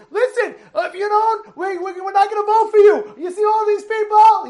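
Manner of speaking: high, loud warning voice; heckling lady. Retold or imitated. Lady at the rally warning the mayor about votes, high and loud. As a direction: imitate a pushy protester laying down terms. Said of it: "the lady's saying".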